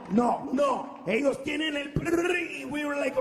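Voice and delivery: usual speaking voice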